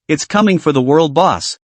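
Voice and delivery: usual speaking voice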